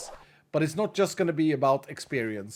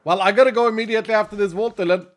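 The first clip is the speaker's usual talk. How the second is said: fast and loud, rushing out the words; fast, loud hurry; hurried; 4 semitones higher, 5 dB louder, much faster than usual. Real, not acted. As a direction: blurt it out in a rush. Rushed hurry announcing leaving.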